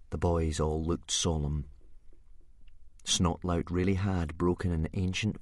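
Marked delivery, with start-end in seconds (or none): none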